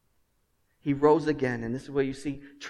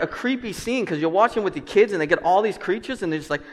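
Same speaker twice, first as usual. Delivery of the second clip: ordinary talk, nothing marked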